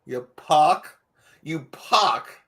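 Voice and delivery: ordinary delivery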